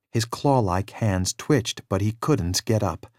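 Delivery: ordinary delivery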